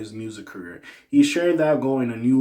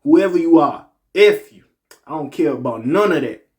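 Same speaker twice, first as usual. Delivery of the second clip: put-on [name] accent; 5 semitones higher, 7 dB louder than usual. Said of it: "So I gotta do the little X and".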